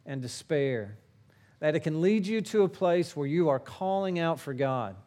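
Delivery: normal talking voice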